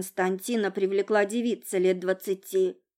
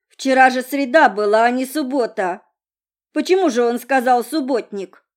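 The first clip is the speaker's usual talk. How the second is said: put-on loud, higher slurred drunken rambling; drunk woman voice; 3 semitones higher, 9 dB louder than usual. A narrator's reading. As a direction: ramble on, tipsy and indignant. Drunk girl rambling complaint.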